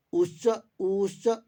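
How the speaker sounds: उच्च with the उ stretched slowly; drawn-out u demo. Shown as a demonstration. Teacher shows students the three vowel lengths, slowly stretching the vowel. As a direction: draw out the उ of उच्च, slowly and deliberately. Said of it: "अब देखते हैं उच्च".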